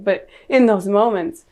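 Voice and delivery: ordinary talk, nothing marked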